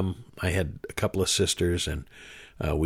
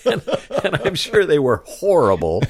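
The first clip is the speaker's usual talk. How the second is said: louder, higher, laughing through the words; loud amused exclamation; amused self-mockery; 8 semitones higher, 7 dB louder than usual. Real, not acted. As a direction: laugh at your own early efforts. Laughs at own early sermons.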